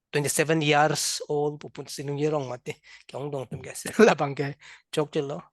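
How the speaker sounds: usual speaking voice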